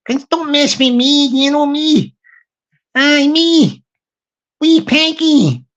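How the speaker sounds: mock-tough Glaswegian back-chat; Peggy. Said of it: "I'll do Peggy talking to M C".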